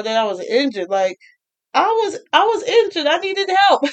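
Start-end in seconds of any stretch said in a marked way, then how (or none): 1.7-3.8 high, laughing confession; admitting the injury with a high laugh; laughing admission. Real, not acted. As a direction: squeak it out high, half laughing at yourself. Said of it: "I was just in pure denial".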